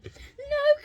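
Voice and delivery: high, panicky No; frightened cry. A narrator's reading. Witch flees the dragon, crying out in fright. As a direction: cry out in alarm. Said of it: "cried the witch".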